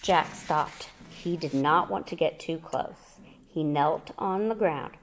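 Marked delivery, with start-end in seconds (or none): none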